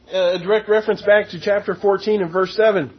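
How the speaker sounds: ordinary delivery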